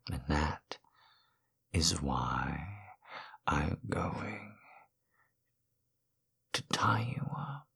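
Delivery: breathy whispered threat; hushed menacing whisper. Acted. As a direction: lean in and whisper it. Whispered threat in dom roleplay.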